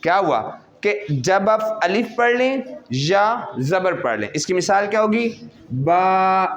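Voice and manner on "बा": recitation drill. Shown as a demonstration. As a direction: call out each letter slowly and clearly. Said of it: "एक एक ग्राम का वज़न".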